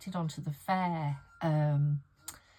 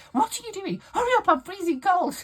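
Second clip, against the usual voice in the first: much higher, louder complaining mum; mock nagging mum; 8 dB louder than usual. Mum in wheelchair nagging her daughter, high shrill mock complaint. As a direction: screech it like a cold, cross old mum. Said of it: "and she was going".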